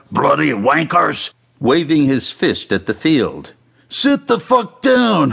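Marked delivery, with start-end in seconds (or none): 0.1-1.3 a touch of a heckler's yell; lightly yelled insult in a character voice; mild mock yell. A narrator's reading. Heavy-set fan heckles the soccer field, mild yell. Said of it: "and yelled". 3.9-5.3 high, drawn-out angry yell; acted yell. A narrator's reading. Man yells at the fan in front, acted high yell. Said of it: "another man yelled from behind him".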